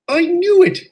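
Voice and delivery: angry outburst. Acted. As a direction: burst out in vindicated anger. Engine hears about the attack.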